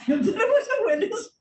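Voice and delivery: high, loud, laughing through the words; real laugh overtaking the line; bursting into laughter. Real, not acted. Laughing at people's baffled reaction.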